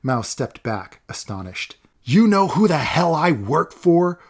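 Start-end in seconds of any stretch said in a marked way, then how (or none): 2.0-4.2 loud, slow furious shout; enraged yell. Acted. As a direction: roar it in blind rage. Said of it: "Olaf yelled with a rage".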